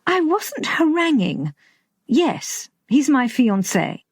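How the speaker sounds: high, indignant denial. Acted. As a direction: snap back, offended. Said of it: "She flushed with anger".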